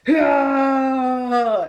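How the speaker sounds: long, loud high-pitched yell; hammed-up heroic scream; battle cry. Hero's battle cry in the dive.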